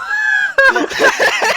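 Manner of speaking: long, very high, loud laughing fit; uproarious laughter. Real, not acted. Game master after the Gucci joke, real uproarious laugh. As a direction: shriek with laughter.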